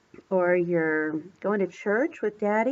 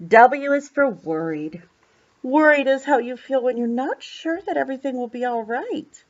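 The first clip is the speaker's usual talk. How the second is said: plain reading voice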